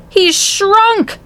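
high, drawn-out shock; appalled exclamation. A narrator's reading. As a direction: exclaim in disbelief, pitch way up. Father appalled at shrunken son.

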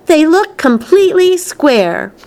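put-on high-pitched child's objection; insistent contradiction. A narrator's reading. Veruca argues with Wonka over candies, insistent. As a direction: press the point in a high voice.

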